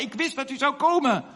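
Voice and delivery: high-pitched retold joy; overjoyed. Old woman greeting the long-awaited preacher, overjoyed. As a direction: act out a delighted welcome. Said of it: "was zo blij".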